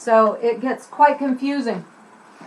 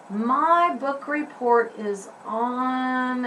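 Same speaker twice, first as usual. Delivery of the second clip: to oneself; 5 dB quieter, much slower than usual. Shown as a demonstration. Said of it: "it might look something like this".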